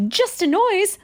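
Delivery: very high pig voice; brushing it off, pitched high. A narrator's reading. Pig scoffs at the thunder, high voiced. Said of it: "said Pig".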